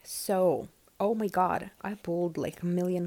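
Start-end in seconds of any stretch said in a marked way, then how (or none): none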